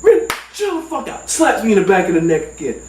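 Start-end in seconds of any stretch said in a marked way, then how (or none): 0.0-1.3 high-pitched acted scolding; exasperated cousin. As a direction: snap someone out of a panic. Cousin scolds panicking friend.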